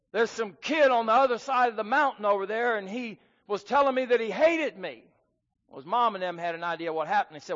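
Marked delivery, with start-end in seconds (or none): 0.1-5.0 put-on upset little boy. Boy reporting to his parents.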